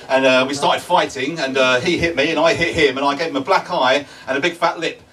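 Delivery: normal talking voice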